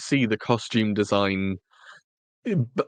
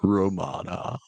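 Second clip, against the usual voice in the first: Tom Baker. Acted. Host offering his co-host a jelly baby in a mock Tom Baker voice. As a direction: offer it theatrically, lingering on the vowels. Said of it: "go into a Tom Baker impression".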